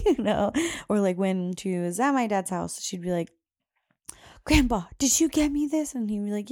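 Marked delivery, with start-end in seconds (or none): 4.5-6.0 lightly voiced child, higher and louder; eager child. Mom imitates daughter asking grandpa about gift, eager. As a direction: imitate a child calling out a question.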